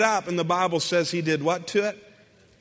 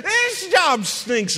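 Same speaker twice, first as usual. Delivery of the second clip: slow, much higher mock whine; griping worker; 10 semitones higher, much slower than usual. Preacher mocking job-hoppers, high slow whining gripe. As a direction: whine it even higher and drawn out.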